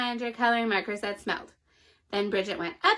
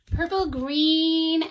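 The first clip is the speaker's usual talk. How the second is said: plain reading voice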